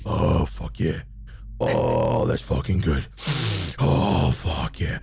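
low, pleasured moans while scratching; blissful groan. Acted. Demon bunny scratching its ear, low and blissful. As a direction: sink into the itch with relief.

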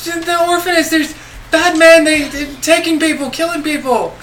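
put-on high, tearful child reporting horrors; small boy whimpering out bad news; whining little boy. Orphan boy telling Miss Hawkins of bad men, high whiny pleading. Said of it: "get the wine out your voice".

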